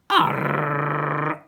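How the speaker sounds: short, loud pirate growl of a roar; one gruff pirate roar. A narrator's reading. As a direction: roar it like a cross sea captain. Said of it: "roared the captain".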